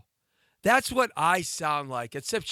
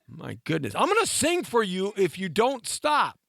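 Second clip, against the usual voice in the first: normal talking voice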